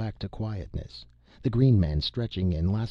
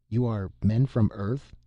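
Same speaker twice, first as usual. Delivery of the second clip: plain reading voice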